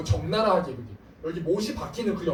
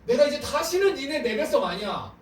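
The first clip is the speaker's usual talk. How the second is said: higher, louder threat; angry God; 7 semitones higher, 5 dB louder than usual. Retold or imitated. God warns his people he will sweep them away, loud angry threat. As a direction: thunder a warning at the people.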